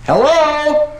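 "Hello?" in a very high, tiny voice, with a laugh; squeaky phone hello. Acted. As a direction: squeak "Hello?" like it's coming from the bulb. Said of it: "and he says".

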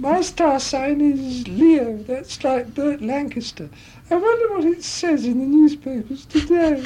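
high, slow, silly showgirl; dim-sounding high comic impression. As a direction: muse aloud in a drawn-out falsetto. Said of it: "say things to the audience like".

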